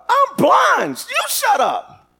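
high, loud defiant outburst; screaming back. Acted. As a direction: scream it back at those hushing you. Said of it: "I'm screaming".

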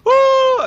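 loud, very high yelp of pain; pained cry. Retold or imitated. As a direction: cry out as the pebble hits. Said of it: "he's going".

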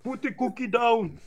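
put-on movie catchphrase in an Austrian accent; hammed-up Austrian action hero; Schwarzenegger. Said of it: "His most famous line".

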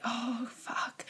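whispered under the breath, almost no voice; muttered curse. Retold or imitated. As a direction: breathe the curse out, barely audible. Cursing under breath at itchy kids.

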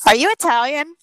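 high, loud astonished asking; amazed question. Retold or imitated. Friend stunned watching dad make pasta, loud and high. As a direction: burst out in disbelief. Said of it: "And she was like".

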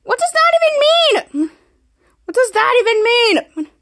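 high, slow, bewildered repeat; high incredulous voice, drawn out; baffled squeak. Acted. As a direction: squeak it out in total confusion. Mika baffled by the toast.

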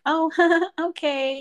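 high, slow, laughing fake-casual okay; breezy pretend acceptance; forced calm okay. Shown as a demonstration. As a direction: act unbothered through a laugh. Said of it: "you just have to be like".

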